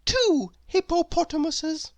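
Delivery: high, bright-eyed know-it-all cry; eager little girl voice. A narrator's reading. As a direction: pitch it way up, keen to answer. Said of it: "said little Miss Brainy".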